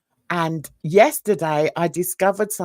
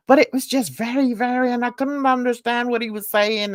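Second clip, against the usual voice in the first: higher, badly missed Irish accent; botched strong Irish accent; 5 semitones higher than usual. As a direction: attempt thick Irish, drifting towards American. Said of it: "that's the wrong accent completely".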